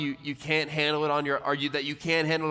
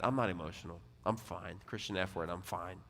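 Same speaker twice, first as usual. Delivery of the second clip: soft, lower, dismissive brush-off; mock shutting down; 6 semitones lower, 10 dB quieter than usual. A proud man brushing off the pastor, dismissive. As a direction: mutter it like you want the talk over.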